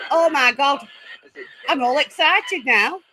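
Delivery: usual speaking voice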